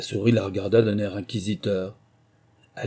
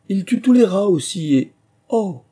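high, loud gush cut short by 'oh'; eager boast breaking off in dismay; carried away; 9 semitones higher, 7 dB louder than usual. A narrator's reading. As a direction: gush excitedly then catch yourself. Gushing, then realises the blunder.

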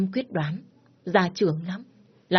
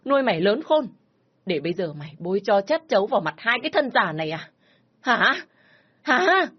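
furious scolding; 3 semitones higher than usual. Acted. Father berating his daughter during the beating, furious. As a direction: berate her, then scream the last words.

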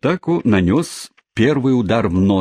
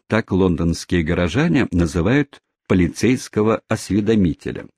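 ordinary delivery

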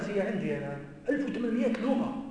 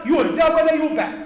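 loud; 4 semitones higher, 8 dB louder than usual. Real, not acted. Quoting the label loudly.

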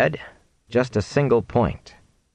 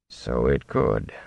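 low, slow, softer reply; deep, measured concession; unruffled companion; 10 semitones lower, 5 dB quieter, slower than usual. A narrator's reading. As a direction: answer the protest slowly, pitched well down. Older companion's calm reply to protest.

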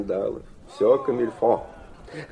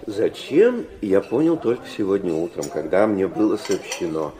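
ordinary delivery

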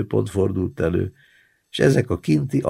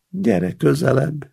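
coaxing; 8 semitones higher than usual. Acted.

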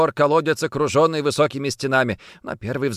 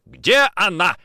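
sharp, louder angry bark; 5 semitones higher, 5 dB louder, slower than usual. Acted. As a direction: bark the question. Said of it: "рявкнул Никитин". (рявкнуть)

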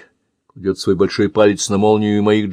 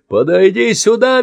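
much higher, louder screech; shrieking command; 12 semitones higher, 5 dB louder than usual. Acted. Man screeches at Andy to come over. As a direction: screech the order. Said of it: "визжит мужчина".

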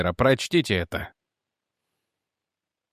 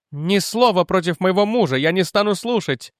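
raised, high indignant woman's voice; indignant widow; 6 semitones higher than usual. Acted. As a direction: refuse to hear a word, outraged. Widow defends husband, indignant. (возмущённая вдова)

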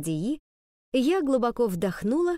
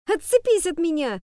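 high thin squeak of a shout; squeaky failed shout; 8 semitones higher, 5 dB louder than usual. Acted. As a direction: try to shout but only squeak. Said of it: "Так, писк какой-то".

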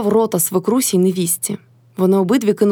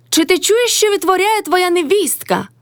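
very high, indignant complaint; crying out; 11 semitones higher than usual. A narrator's reading. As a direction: call the husband to witness, high-pitched. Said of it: "крикнула Кайдашиха на свого чоловіка".

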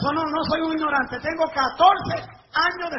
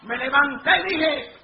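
ordinary talk

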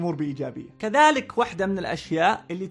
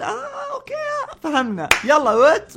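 very high, slow, drawn-out okay, then louder; impatient brush-off; 13 semitones higher, 9 dB louder, slower than usual. Retold or imitated. Cutting off a slow talker with a high, slow, impatient okay. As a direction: wave the bore off. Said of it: "فأحاول إني أقول".

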